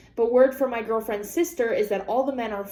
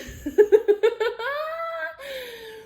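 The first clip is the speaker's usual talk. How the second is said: high squealing laugh, near tears; high crying laugh; 13 semitones higher than usual. Real, not acted. Laughing at the story.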